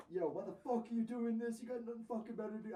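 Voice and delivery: high, indignant retold rant; going off angrily. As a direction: act out laying into someone, pitch jumping up. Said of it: "you started going off on him".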